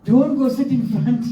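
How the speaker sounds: high, laughing speech; helpless amusement. Real, not acted. Warning self away from the guru in retelling, laughing. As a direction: squeeze the words out between real laughs. Cracking up over retold suspicion.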